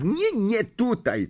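high, drawn-out protest; crying out. A narrator's reading. Harry warns someone off his seat in the dark, crying out. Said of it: "krzyknął Harry".

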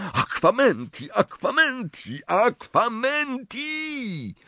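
high, drawn-out spell rising in despair; frantic spell. A narrator's reading. Harry failing to fill the cup, frantic repeated spell.

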